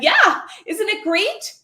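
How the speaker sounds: high, loud imitation of a delighted kid; excited. Fifth-grade daughter thrilled by her screen name, excited. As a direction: gush it proudly, drawn out and high. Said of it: "She immediately excitedly said".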